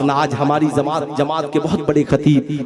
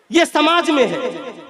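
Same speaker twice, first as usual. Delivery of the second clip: high, slow, ringing pulpit delivery; declamatory preaching; 11 semitones higher, slower than usual. Real, not acted. Preacher denouncing sons' claims to everything, declamatory preaching. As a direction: proclaim it slowly, high over the crowd.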